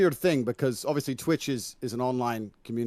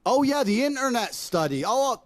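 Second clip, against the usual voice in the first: much higher, mocking sarcasm; sarcastic; 13 semitones higher, 3 dB louder than usual. Real, not acted. Mocking a debate opponent's internet study, sarcastic. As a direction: scoff at the idea with exaggerated mock surprise.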